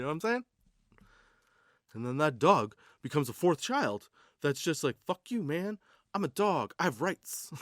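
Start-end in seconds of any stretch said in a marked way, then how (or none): 5.1-7.5 higher, mildly put-on brat voice; defiant dog. The dog talking back to its owner, a hint of a bratty voice. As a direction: talk back like a teen.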